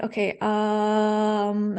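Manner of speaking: imitating a long, stalling filler; drawn-out hesitation. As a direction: hold the um on one level note. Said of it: "and then you close your mouth".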